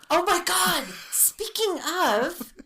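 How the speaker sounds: high, loud excited exclamation; excited outburst. Real, not acted. Suddenly remembering the pigeon story, excited loud outburst. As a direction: burst out as it suddenly comes to mind.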